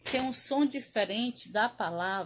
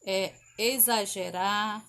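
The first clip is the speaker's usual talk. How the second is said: ordinary talk, nothing marked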